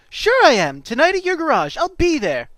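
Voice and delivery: put-on high, loud kid voice; bright-eyed cartoon enthusiasm; eager kid Oliver. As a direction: agree like a keen youngster.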